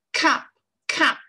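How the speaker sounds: "Cup" with a loud breathy puff on the c; aspirated cup. Shown as a demonstration. Teacher showing the aspirated c of "Cup", loud. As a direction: push a little puff of air on "Cup". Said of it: "on a le petit souffle".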